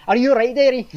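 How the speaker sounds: mock Scouse concern; Merseyside accent. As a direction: play a kindly Liverpool woman checking in. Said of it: "she was Merseyside".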